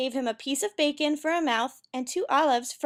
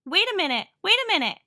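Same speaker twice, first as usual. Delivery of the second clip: high, swooping interruption; urgent cry; 7 semitones higher, 4 dB louder, faster than usual. A narrator's reading. Narrator breaking into the story, an urgent cry. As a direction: cry out to halt everyone. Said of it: "cried Jack the narrator".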